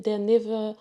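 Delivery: usual speaking voice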